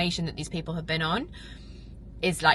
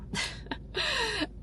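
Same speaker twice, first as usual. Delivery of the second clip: high teary laugh; 7 dB quieter than usual. Real, not acted. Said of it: "so much gratitude".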